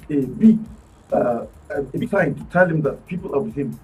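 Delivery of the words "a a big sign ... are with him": normal talking voice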